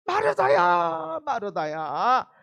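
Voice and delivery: loud, slow, drawn-out call of her name; plead, stretching every syllable; earnest plea, slow. Retold or imitated. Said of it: "왜 간곡하게 말을".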